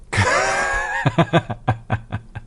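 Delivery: breathy, wheezing laugh; real laugh trailing into wheezes. Real, not acted. Laughing at the brain bit.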